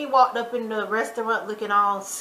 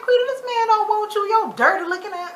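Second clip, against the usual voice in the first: much higher, louder telling-off; scolding her friend through the screen; exasperated outburst; 11 semitones higher, 3 dB louder than usual. Retold or imitated. Yelling at Quita on screen, exasperated. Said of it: "I'm like".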